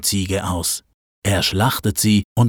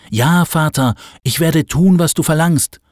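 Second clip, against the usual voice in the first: loud and raised, forcing himself to answer; laut gesagt; 7 semitones higher, 3 dB louder than usual. A narrator's reading. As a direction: say it out loud, higher, masking his tears. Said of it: "Laut sagt er". (laut und bemüht gefasst)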